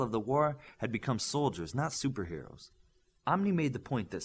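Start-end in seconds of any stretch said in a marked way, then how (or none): none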